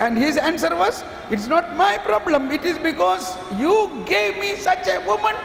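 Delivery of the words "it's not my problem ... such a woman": whining blame. Acted. Adam blaming God's gift.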